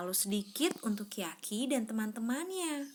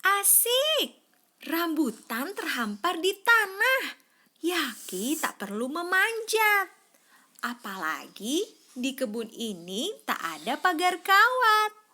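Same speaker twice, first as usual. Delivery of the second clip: delight; 5 semitones higher, 6 dB louder than usual. A narrator's reading. Yaki delighted finding rambutans.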